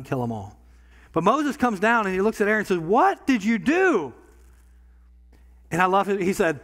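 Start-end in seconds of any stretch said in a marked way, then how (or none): 2.8-4.1 stern demand. Acted. Moses confronting Aaron.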